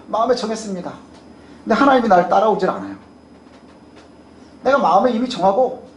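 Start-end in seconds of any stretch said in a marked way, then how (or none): none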